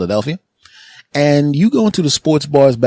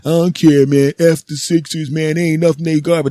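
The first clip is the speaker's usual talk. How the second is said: higher-pitched put-on trash-talk voice; mouthy contrarian friend; 6 semitones higher than usual. Friend in Celtics jacket taunting Philadelphia fans, defiant. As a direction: play the pal taunting the home fans.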